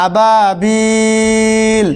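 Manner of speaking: "আবাবিল" with the last vowel held very long; slow, level hold on the end of "আবাবিল"; three-alif elongation. Shown as a demonstration. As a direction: recite "আবাবিল", stretching the final ই evenly. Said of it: "এটা তিন আলিফ পরিমাণ হয়ে যাবে".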